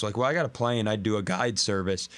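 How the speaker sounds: usual speaking voice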